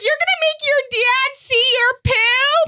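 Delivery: high, drawn-out mock mom; a high, incredulous mom impression; imitated mom. Mom to daughter about dad seeing poo, imitated high and drawn out. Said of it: "my mom's like".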